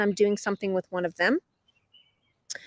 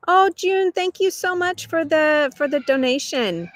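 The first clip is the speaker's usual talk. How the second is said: high, louder burst of gratitude; delighted thanks; 7 semitones higher, 3 dB louder than usual. Real, not acted. Streamer thanking a viewer for a donation, delighted. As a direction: gush with surprised gratitude, pitched up.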